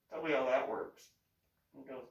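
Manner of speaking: ordinary delivery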